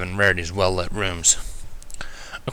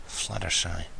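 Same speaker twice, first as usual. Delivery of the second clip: much softer, barely voiced name; whispered; 4 semitones lower, 10 dB quieter, slower than usual. A narrator's reading. Injured narrator whispers Fluttershy's name, very soft. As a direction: breathe her name through the pain. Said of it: "I whispered".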